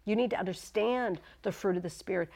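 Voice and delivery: usual speaking voice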